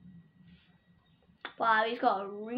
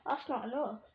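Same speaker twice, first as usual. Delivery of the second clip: ordinary talk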